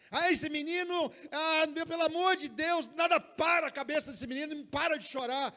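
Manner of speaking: high, loud mock complaint about the baby; mother fed up with her crying baby, complaining loudly; exasperated mother. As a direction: whine like a worn-out mom.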